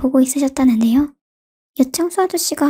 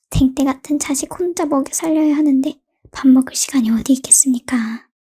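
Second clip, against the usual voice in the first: ordinary delivery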